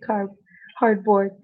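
ordinary delivery

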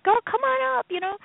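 put-on high, sing-song "Girl, come on up"; bright invitation. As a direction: invite her in a lifted voice. Mentor's voice quoted.

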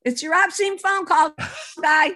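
put-on high, loud child calling to Mom; a kid yelling for Mom. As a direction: sing out across the house like a kid. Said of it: "my kids would answer the phone".

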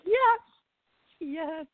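very high, giddy squeal of joy; ecstatic squeal. Retold or imitated. Thrilled fan squealing over a reply from Cynthia. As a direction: squeal it out, overjoyed.

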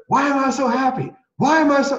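loud, high-pitched happy declaration. Retold or imitated. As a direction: belt it out high and full of inflection. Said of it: "Say it out loud".

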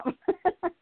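short high laugh; embarrassed laugh. Real, not acted. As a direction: laugh at herself. Laughing at her own anger.

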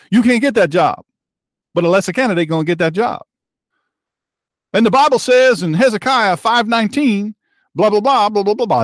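mock preacher. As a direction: keep the mock sermon going, trailing into blah blah.

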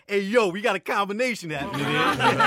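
loud, high call-out, imitating an impressed crowd; hyped crowd reaction. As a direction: announce her arrival like an excited onlooker. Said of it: "everybody be like".

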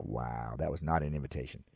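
low, quiet deadpan aside; dry sarcasm. Retold or imitated. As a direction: mutter it drily. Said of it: "I'm like".

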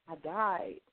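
quiet, puzzled murmur; a soft, puzzled mother; perplexed. Retold or imitated. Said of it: "with this perplexed look".

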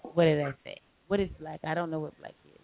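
ordinary delivery

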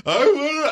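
high mock wailing; imitated child whine. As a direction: whine like a crying little boy. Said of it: "He's like".

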